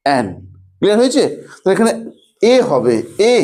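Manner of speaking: ordinary delivery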